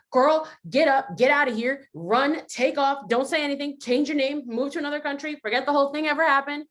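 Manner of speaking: high, loud inner voice pushing to flee. Acted. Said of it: "was a little bit louder or more, like, persistent".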